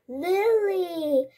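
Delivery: high, bright punchline. A narrator's reading. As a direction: deliver the joke's payoff. Punchline of grandpa's joke.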